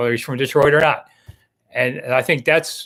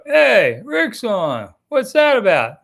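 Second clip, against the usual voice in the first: mock British accent, pitched higher; 7 semitones higher, much slower than usual. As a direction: chirp it like a Brit noticing the show is on. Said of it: "They'll say it in a British accent".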